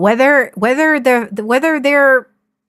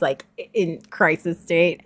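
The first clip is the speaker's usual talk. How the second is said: ordinary talk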